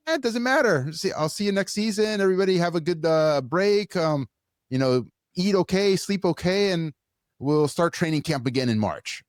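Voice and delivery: high, breezy, dismissive coach voice; mock careless coach. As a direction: shrug it off like a coach who doesn't care. Mocking careless MLS coach.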